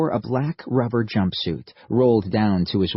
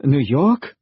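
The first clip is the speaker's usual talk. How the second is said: disbelieving German soldier; 5 semitones higher, 5 dB louder than usual. Acted.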